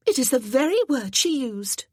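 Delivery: put-on high, loud breathless pleading of an eager girl; a girl begging to go to the party; excited young girl.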